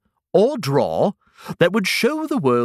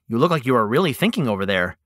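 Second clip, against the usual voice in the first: ordinary delivery, nothing marked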